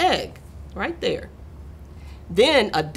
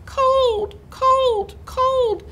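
very high, tiny complaining voice; shivering babies; much slower than usual. A narrator's reading. Chilly babies to the cat, shivering high plea. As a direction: peep each word pitifully, way up high. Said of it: "said the shivering babies".

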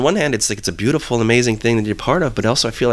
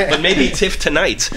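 laughing; 8 semitones higher, slower than usual. Real, not acted. Laughing through a joke.

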